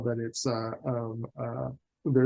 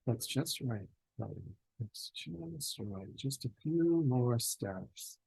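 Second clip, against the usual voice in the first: very soft, slow, drawn-out coaxing; voice in the head; 8 dB quieter, slower than usual. Acted. Game master as a voice in Chloe's head, soft and slow. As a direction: lure them on slowly and softly. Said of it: "you hear a voice, um, in your head".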